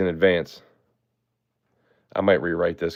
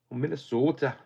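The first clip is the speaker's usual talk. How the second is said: Minnesota accent; 6 semitones higher than usual. Shown as a demonstration. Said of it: "I like that accent a lot".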